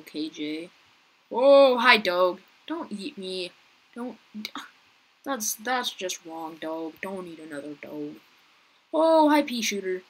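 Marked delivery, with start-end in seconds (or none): 1.3-2.4 higher and much louder hi to the dog; excited greeting. Real, not acted. Greets a dog skin loudly. 8.9-10.0 excited greeting. Real, not acted. Greets a player.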